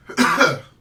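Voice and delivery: sharp dry cough; a sudden loud cough. Real, not acted. Coughs during update.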